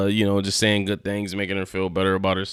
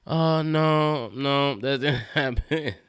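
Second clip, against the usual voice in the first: higher-pitched mock dismay, breaking into a laugh; dismayed disbelief; 4 semitones higher, slower than usual. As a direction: retell a let-down refusal, amused. Younger self rejects Issa's suggested future.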